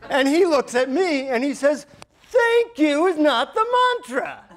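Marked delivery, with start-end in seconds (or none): 2.3-4.4 very high, slow, drawn-out scolding; exaggerated guru correction, pitched way up; mocking rebuke. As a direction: throw the angry thanks back at him. Guru rebukes him, mimicking his shout.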